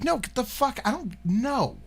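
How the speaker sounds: high-pitched mock protest; incredulous refusal. Repeating how he turned down the stranger in the car, high and incredulous. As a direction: jump high on each refusal.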